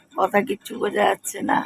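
whispering through a cold; hoarse whisper. Real, not acted. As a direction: voice almost gone, whispered.

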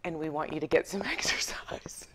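soft, breathy, condescending hush; mock the smug clerk quietly; snooty whisper. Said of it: "and he said to me".

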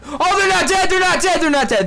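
high-pitched alarmed repetition; alarmed gamer outburst; panicked yelling. Real, not acted. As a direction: shriek it in a rush, pitch starting very high. Panicking that enemies survived.

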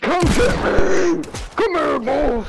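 high, excited taunt; taunting shout. Real, not acted. Prey dares hunter to come, high taunting shout. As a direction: goad the hunter with a high holler.

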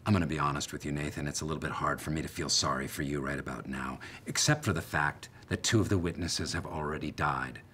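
low-pitched, measured rebuke; cool, understated disapproval; unsympathetic. Acted. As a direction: drop the voice and deny sympathy. Host coldly withholds sympathy.

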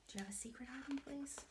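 fast, hushed question; conspiratorial whisper to the child. Shown as a demonstration.

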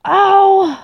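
high, sudden "ow" cry; pained yelp. Real, not acted. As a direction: yelp out in pain. Reacts to getting hurt in game.

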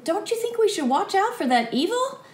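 put-on high worried young squire; anxious boy pleading. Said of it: "And the squire's saying".

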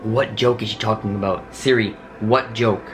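ordinary delivery

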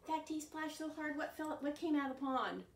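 normal talking voice

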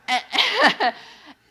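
sudden high burst of laughter; bright laugh. Real, not acted. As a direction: break into a high real laugh. Laughs before school memory.

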